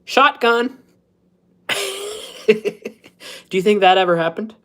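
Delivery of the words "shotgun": mock call-out, pitched up; playful yelled claim to the front seat; calling shotgun. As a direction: shout dibs on the front seat. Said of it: "he calls out".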